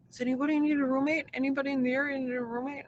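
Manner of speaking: fast, high, eager asking around; rushed plea. Retold or imitated. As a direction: rattle it off hoping someone bites. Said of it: "she was just like".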